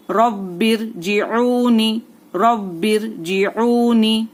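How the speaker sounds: "रब्बिर जिऊनी" slowly, with a firm doubled "ब"; firm doubled consonant. Shown as a demonstration. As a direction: press the "ब्ब" in "रब्बिर", stretch the "ऊ". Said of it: "तशदीद है तो सख्ती भी होगी". ("रब्बिर" में तशदीद की सख्ती, धीमी तिलावत)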